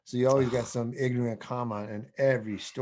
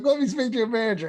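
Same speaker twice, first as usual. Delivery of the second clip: high, loud, fast mock demand; fast indignant fake voice; 12 semitones higher, 5 dB louder, faster than usual. Prank caller demands the manager, fast and outraged. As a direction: rattle off an outraged customer's demand. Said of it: "he tries to do this fake voice".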